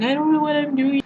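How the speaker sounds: high-pitched mock-timid voice; shy and scared. Her shy first-year self at college, high scared voice. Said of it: "this shy, scared person".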